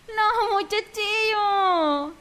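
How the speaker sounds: high, slow fake whimper; hammed-up fake crying; mock crying. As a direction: wail it like a pretend sob. Mock lament about no beer.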